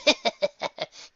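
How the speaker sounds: high scoffing laugh; short mocking laugh; dismissive laugh. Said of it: "Trixie laughed".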